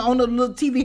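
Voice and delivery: usual speaking voice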